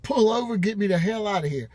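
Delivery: retold scream. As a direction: imitate a frantic scream, held back. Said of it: "I just screamed".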